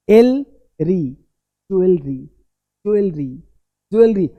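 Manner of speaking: "ज्वेलरी" built from joo, el, ree; correct pronunciation. Shown as a demonstration. Teacher drills students on what to say, correct pronunciation. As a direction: run "ज्वेलरी" together as JOO-el-ree, spaced repeats. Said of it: "यहाँ ध्यान देना क्या बोलना है".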